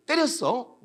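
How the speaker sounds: retold alarmed teacher; startled. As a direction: jolt upright in shock. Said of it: "깜짝 놀랐어요".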